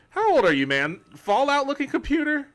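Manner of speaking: high, loud, incredulous squeal at Fallout looking computer; mock outrage. Real, not acted. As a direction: act offended by the comment, pitch shooting up.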